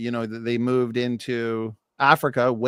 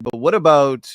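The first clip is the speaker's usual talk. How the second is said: normal talking voice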